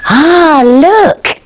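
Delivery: usual speaking voice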